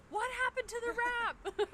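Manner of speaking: high, slow, dismayed cry; distressed. Retold or imitated. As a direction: wail it in disbelief. Frantic texting a friend about wrap.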